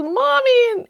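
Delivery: put-on high wailing call of a little kid, Mommy; a small boy running to his mom in tears; child calling his mom in distress; crying child. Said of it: "and he says".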